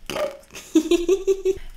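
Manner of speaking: bright burst of laughter; high laugh. Real, not acted. Laughs after singing.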